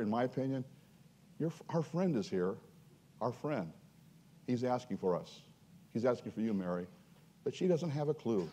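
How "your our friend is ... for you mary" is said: softer, hushed loving invitation; tender hope. Acted. Preacher voicing Mary telling her sister, softer and tender. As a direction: let it fall gently, like sharing good news in secret. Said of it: "What Jesus would have loved to have heard".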